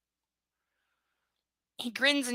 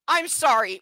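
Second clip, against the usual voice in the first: normal talking voice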